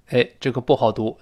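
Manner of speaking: ordinary delivery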